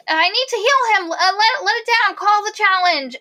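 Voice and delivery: very high mock-frantic demand; high, urgent imitation of a pleading healer; retold desperate plea. Retold desperate plea to stop challenge.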